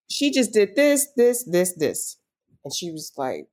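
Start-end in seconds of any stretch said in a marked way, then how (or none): 0.1-2.2 exasperated complaint. Retold or imitated. Retold heated complaint to aunt.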